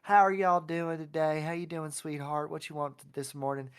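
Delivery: put-on loud, friendly Southern accent; Southern diner accent. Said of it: "the familiar accent".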